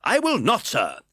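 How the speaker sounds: high, loud defiance; indignant refusal. A narrator's reading. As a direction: refuse flatly. Brownlow voiced in reading.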